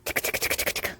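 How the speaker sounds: soft breathy whisper, barely voiced; hushed teasing whisper before the tickle. Real, not acted. Tickling the baby.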